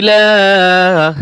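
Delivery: ইলাহ with the la stretched long; long vowel. Shown as a demonstration. Teacher showing the long vowel in ইলাহ. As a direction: draw out the la in ইলাহ. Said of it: "টান হবে".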